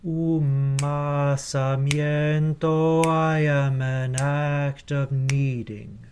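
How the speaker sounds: Umasamiento, I am run together with no glottal stop before I; blended, no glottal stop. Shown as a demonstration. Choir director demonstrating the vowel blend, slow and smooth. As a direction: glide the end of Umasamiento straight into I, slowly. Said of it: "like this".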